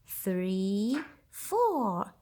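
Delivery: normal talking voice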